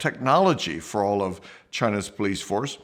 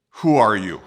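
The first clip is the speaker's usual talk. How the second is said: authoritative demand; 7 dB louder than usual. Retold or imitated. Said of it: "the way police can ask".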